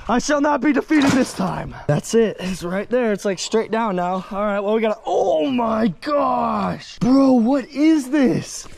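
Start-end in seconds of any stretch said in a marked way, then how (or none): 5.1-8.7 slow, drawn-out astonished shout; stunned shout. Real, not acted. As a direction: blurt it out in shock. Huge oily find surfaces.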